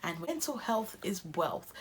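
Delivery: ordinary talk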